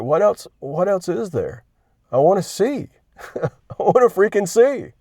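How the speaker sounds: as if the answer were obvious; exasperated plea. Pretend. Answering Jesus's question as the blind man, exasperated.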